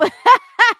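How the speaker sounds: very loud high burst of laughter; laughing. Real, not acted. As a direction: crack up loudly. Laughs at Siri's nope.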